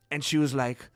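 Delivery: ordinary talk, nothing marked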